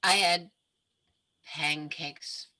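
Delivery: soft, low, slow and dreamy; put-on love-struck murmur. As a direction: sigh it out, smitten. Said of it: "love struck".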